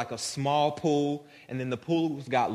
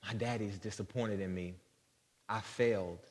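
put-on hushed, lower, dejected murmur of a child; understated, subdued sadness, no sobbing; 4 semitones lower, 8 dB quieter than usual. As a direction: quietly voice a son's sense of failure. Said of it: "He's weeping, he's crying".

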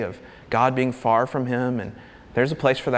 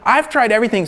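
raised, fed-up cry; exasperated God; 7 semitones higher, 5 dB louder, faster than usual. Retold or imitated. Preacher voicing God, fed up and raised. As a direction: sound at wit's end. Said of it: "He's like".

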